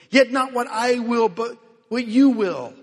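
Jesus voiced in Gethsemane, loud and pleading; anguished prayer. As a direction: cry it out to the Father.

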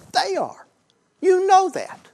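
high, loud preaching cry; preacher shout. Real, not acted. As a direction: drive the point home at full force. Preacher shouting point home.

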